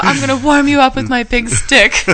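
put-on laughing through a Brazilian accent; retelling the professor's line with a laughing Brazilian accent. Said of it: "with his Brazilian accent".

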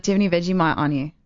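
quick, casual Aussie patter; fake Australian accent. Shown as a demonstration. Pretend Australian asking clubbers for Vegemite, quick casual accent. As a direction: toss it off fast in an Australian accent. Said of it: "And I'll be like".

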